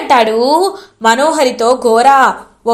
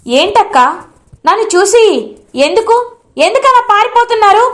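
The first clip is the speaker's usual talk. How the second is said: loud demanding question; 7 semitones higher than usual. Acted. Bhagi confronting her sister who runs away, loud and high. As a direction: pitch climbing higher, pressing harder each word. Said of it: "గట్టిగా అడుగుతుంది".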